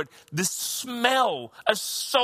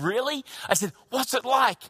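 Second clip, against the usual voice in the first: higher, eager questions; eager anticipation; 6 semitones higher than usual. Retold or imitated. Son asking mother about the new house, eager. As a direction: ask keenly, hoping for good news. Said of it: "with great anticipation".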